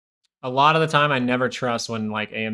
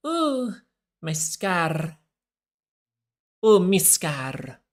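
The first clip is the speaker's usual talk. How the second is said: mock Spanish accent pitched much higher, drawn out at the end; Spanish Harry Potter; 7 semitones higher, much slower than usual. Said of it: "that's the Spanish Harry Potter".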